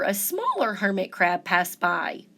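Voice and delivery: plain reading voice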